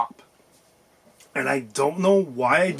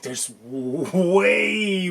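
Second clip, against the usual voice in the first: "way" stretched long and pitched up; drawn-out WAY; 4 semitones higher, much slower than usual. Real, not acted. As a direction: wind up slowly into a long, high "way". Exaggerating how much game remains.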